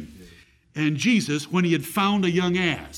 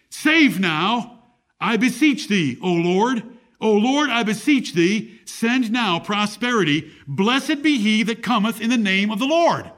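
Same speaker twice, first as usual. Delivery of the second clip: scripture reading; 4 semitones higher, 4 dB louder than usual. Acted. Preacher proclaiming the psalm's plea to the congregation, raised and ringing. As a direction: lift the plea up like a psalm from the pulpit. Reads Psalm 118 aloud.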